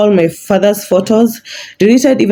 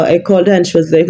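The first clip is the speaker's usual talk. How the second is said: normal talking voice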